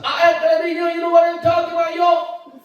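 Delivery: loud, high, slow shouted street English. Retold or imitated. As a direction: shout it like a hyped-up rapper. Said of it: "아프리칸 아메리칸 나와서". (크고 높은 흑인 영어 흉내)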